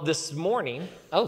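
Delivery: ordinary talk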